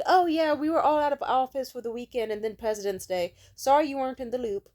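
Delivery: lightly sing-song fake apology; a hint of the manager's breezy excuse; mock manager. Mimicking the manager's excuse, lightly sing-song.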